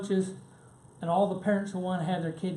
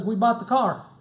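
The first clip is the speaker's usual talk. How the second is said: excited announcement; 4 semitones higher, 7 dB louder than usual. Retold or imitated. Daughter announces purchase triumphantly.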